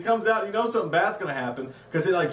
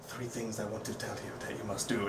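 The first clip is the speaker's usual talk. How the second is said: much lower, much softer, menacing gangster growl; mocking an over-the-top gangster's threat; 9 semitones lower, 13 dB quieter than usual. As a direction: threaten it low like a movie gangster.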